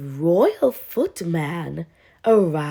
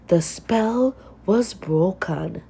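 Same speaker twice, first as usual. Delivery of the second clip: plain reading voice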